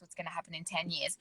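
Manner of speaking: dropped, quick. Shown as a demonstration. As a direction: let the last question fall away quietly and quickly. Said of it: "remember when we did that exercise".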